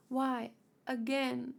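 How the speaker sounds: usual speaking voice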